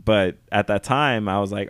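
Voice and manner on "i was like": ordinary talk, nothing marked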